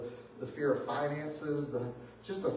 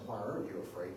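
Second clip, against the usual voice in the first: soft question; 8 semitones lower, 8 dB quieter than usual. Retold or imitated. Jesus' question quoted softly.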